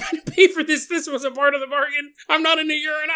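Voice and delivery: high, laughing protest; mock outraged whine. Client protesting the pee: high laughing mock outrage. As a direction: squeal it like a cheated customer. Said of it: "He's like".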